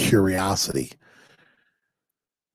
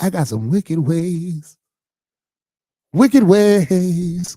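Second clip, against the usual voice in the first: high, loud, slow line for the congregation to echo; slow, high, loud call to repeat; preached for repeating; 13 semitones higher, 8 dB louder, much slower than usual. Shown as a demonstration. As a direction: give it out for them to say back. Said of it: "Come on, say it like that".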